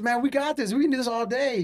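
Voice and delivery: high, upbeat retold cheerleading; retold pep talk. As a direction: gee up a tired teammate. Retold pep talk to tired teammate.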